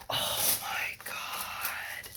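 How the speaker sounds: breathless tearful whisper; slow stunned whisper; awed whisper. Real, not acted. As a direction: barely breathe it out, close to tears. Overwhelmed unboxing a wished-for palette.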